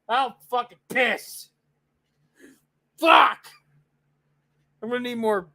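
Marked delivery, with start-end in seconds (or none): 3.0-3.5 loud curse. Real, not acted. Frustrated outburst at trailer.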